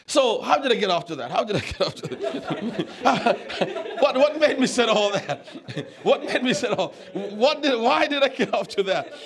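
laughing. Real, not acted. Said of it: "laughs at his own digression".

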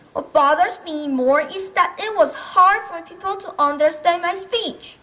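ordinary talk

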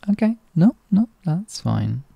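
ordinary delivery

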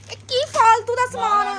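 high, loud exasperated scolding; indignant complaint; dramatic loud aunty. Real, not acted. Scolding husband over groceries.

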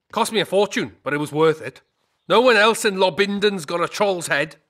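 loud, proud bragging about the prize; proud boast. Acted. Trader boasting loudly to Geralt about his rare trophy. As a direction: crow about the troll's head to the customer.

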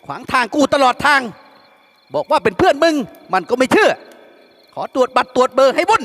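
high, loud rowdy complaining; loud grumbling. A narrator's reading. Yam griping to his friend about the guards, high and loud. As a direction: rant at him half in jest. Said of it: "ไอ้หยามก็โวยต่อ".

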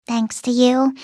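usual speaking voice

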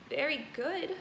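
ordinary delivery

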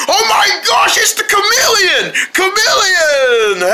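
high scared yelling; panicked shout. Acted. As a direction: scream it in terror. Sees chameleon, screams.

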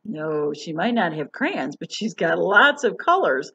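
lively picture-book reading voice; reading to children. Acted. Teacher reading a storybook to children, lively. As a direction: read it bright and expressive for kids.

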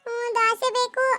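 slow, stretched, sulky plea; tearful whining. Acted. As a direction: whine for it, close to crying. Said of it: "ಅಳ್ಬೇಡಿ, ಅಳ್ಬೇಡಿ". (ಅಳುತ್ತಾ ಹಟ ಮಾಡುವುದು)

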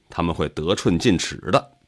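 ordinary delivery

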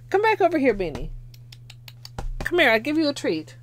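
high sweet call to a cat; coaxing pet voice. Real, not acted. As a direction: lure the cat with a treat.

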